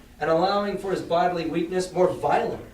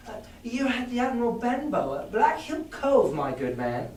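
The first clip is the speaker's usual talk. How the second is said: put-on young boy voice; 8 semitones higher than usual. Boy voiced answering the beggar.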